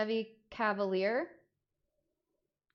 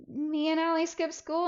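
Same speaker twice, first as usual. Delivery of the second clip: higher, small sheepish admission; sheepish confession; 9 semitones higher, 3 dB louder than usual. Retold or imitated. Teen to mom, sheepish higher confession. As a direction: confess it meekly. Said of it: "I'm like".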